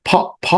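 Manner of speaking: ফ made with both lips popping softly; p sound. Shown as a demonstration. Teacher showing pupils the p sound with both lips. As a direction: pop the lips for ফ. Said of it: "দুইটা ঠোঁট লাগবে".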